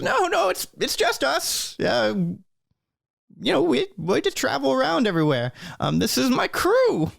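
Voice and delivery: put-on high-pitched elderly gnome voice; Burgle Timbers. Old gnome introduces his crew, high elderly voice. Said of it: "Burgle says".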